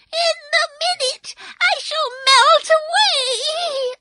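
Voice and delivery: very high loud shriek; screaming witch. Acted. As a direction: shriek in panic. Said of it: "she screamed".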